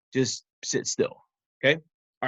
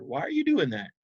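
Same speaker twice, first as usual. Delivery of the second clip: ordinary delivery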